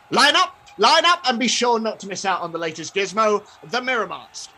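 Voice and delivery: loud, high market barker's cry; big rising sales pitch; showman stallholder. Acted. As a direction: call the crowd in to see your gizmo. Stallholder hawks his gizmo.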